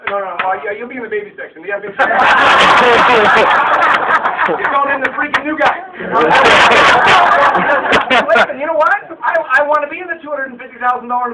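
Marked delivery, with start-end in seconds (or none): 2.2-4.4 loud, high burst of laughter; big laugh. Real, not acted. Laughing at baby section joke. 5.9-8.2 loud, high burst of laughter; big laugh. Real, not acted. Laughing at new guy joke.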